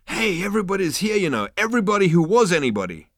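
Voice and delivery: high, fast excited chatter; excited tipsy. Acted. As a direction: gush like a tipsy star. Excited Zaphod.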